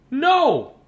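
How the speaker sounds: put-on high loud child's no; shouted no. A narrator's reading. Matthew insists to his dad, loud. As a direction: insist like an eager kid. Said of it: "said Matthew".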